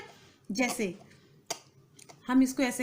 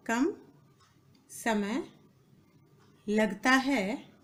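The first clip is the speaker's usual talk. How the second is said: each word apart, long pauses; word-by-word dictation; much slower than usual. Shown as a demonstration. Teacher dictating to students, slow and broken up. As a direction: dictate slowly, leaving time to write.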